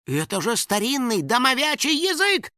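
high-pitched astonishment; amazed gasp. A narrator's reading. House spirit recognizing his ancient language, amazed. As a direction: marvel in a high little voice. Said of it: "ахнул Финик".

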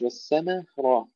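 usual speaking voice

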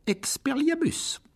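shouted spell. Acted. Harry casts the disarming spell at Lockhart, crying out. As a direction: cast it with full force.

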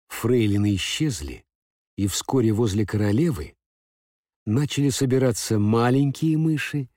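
usual speaking voice

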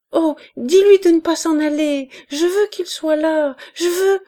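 high, loud crying plea; tearful pleading. A narrator's reading. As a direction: sob out the demand. Enfant délirante supplie en pleurant. (supplication en larmes, aiguë et forte)

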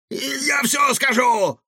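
high, drawn-out shouted surrender; desperate promise. A narrator's reading. Trapped cook promises to tell all, desperate. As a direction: give in with a slow high cry. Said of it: "тут же пообещал Чингисхан".

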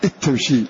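إذ تمشي as "itamshi", no trace of ذ; dh merged into t. Shown as a demonstration. Teacher modelling the recitation: إذ تمشي with a doubled t. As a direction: double the ت and drop the ذ. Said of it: "ولا يبقى شيء من أثر هذه الذال".

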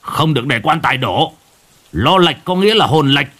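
shout. A narrator's reading. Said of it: "Ông cụ Thiết quát".

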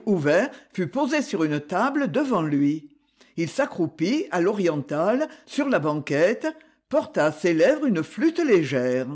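usual speaking voice

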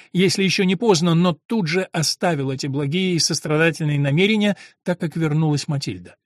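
ordinary delivery

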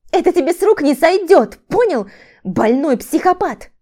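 high, loud threat; furious, shrieked insult; angry cry. A narrator's reading. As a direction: cry out in outrage. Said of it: "вскрикнула".

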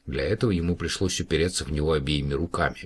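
ordinary delivery, nothing marked

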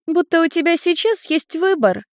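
very high, mocking child voice; laughing little girl. A narrator's reading. Little girl reminds Ian he has no choice, gleefully mocking. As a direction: crow at him in a piping girlish voice. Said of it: "Люся рассмеялась".

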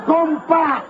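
a hint of Galtieri's balcony address; Galtieri impression. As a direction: mimic the general starting his speech at the microphone.

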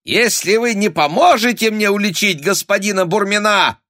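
high, loud squealed ultimatum; shrill, agitated blackmail; threatening shriek. A narrator's reading. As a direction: screech the threat without letting up. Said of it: "угрожающе взвизгнул".

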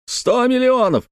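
very high-pitched, anguished outcry; extreme agitation. Acted. Uncle repeating his lost fortune, wildly agitated. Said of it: "в состоянии крайнего возбуждения".

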